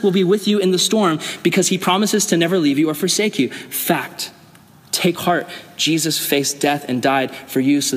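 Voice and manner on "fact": softly whispered single word. Acted. Pastor punctuating promises, whispered. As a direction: drop to a hush for emphasis.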